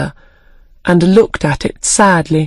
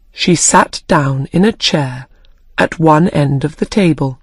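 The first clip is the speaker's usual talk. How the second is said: plain reading voice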